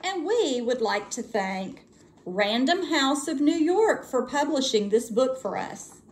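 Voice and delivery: usual speaking voice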